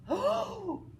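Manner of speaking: high awed oh; crowd's amazed oh. Acted. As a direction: gasp out oh.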